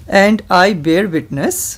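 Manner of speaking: slow, over-enunciated dictation for repeating; said slowly. Shown as a demonstration. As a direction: spell out each word slowly to be repeated. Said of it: "I will say this slowly".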